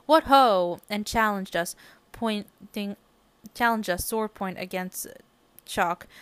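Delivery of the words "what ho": loud, hearty knightly call; shouted. A narrator's reading. Teacher in armor challenges the class, loud hearty shout. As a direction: bellow it like a teacher in Roman armor.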